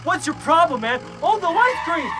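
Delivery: high-pitched mock agitated voice; mimicked other driver. Other driver dodging the fight at the light, high mimicked voice. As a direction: imitate the driver backing out.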